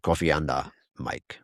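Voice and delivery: low and soft with a mock West African lilt, fading away; West African accent. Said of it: "that was my West African accent".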